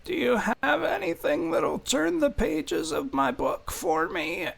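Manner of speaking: very high, soft character voice; thin high-pitched, quiet customer; demanding half-elf customer. Acted. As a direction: make an odd request in a high, hushed voice.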